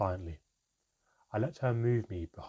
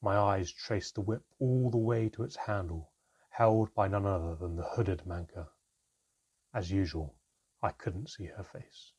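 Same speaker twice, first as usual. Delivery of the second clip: ordinary delivery, nothing marked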